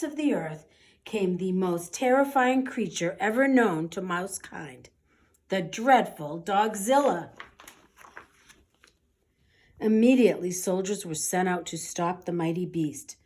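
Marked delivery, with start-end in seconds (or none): none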